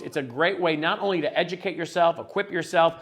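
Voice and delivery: ordinary talk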